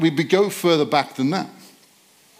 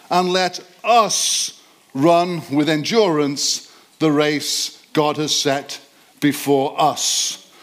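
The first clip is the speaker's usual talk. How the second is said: slow emphatic reading; slower than usual. Shown as a demonstration. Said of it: "I'm going to put an emphasis on some particular words".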